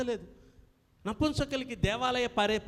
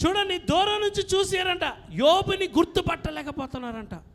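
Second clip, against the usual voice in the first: louder, higher sermon voice; full-force preaching; 8 semitones higher, 7 dB louder than usual. Acted. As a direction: drive the names home like a preacher. Preacher explains the verse loudly. (బిగ్గరగా బోధ)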